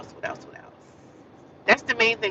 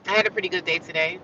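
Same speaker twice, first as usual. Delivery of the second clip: ordinary delivery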